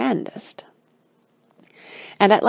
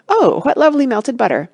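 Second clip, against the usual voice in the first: higher, louder storybook delight; 5 semitones higher, 5 dB louder than usual. A narrator's reading. Father finds the melted tigers, delighted. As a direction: exclaim with pleased wonder on a big "Oh". Man finds pool of butter.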